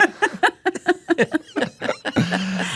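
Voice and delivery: long high burst of laughter. Real, not acted. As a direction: crack up completely. Big laugh.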